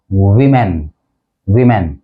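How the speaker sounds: low, careful वीमेन with "wi"; correct pronunciation. Shown as a demonstration. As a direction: start वीमेन with an "ih" vowel. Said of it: "इसका जो correct pronunciation है".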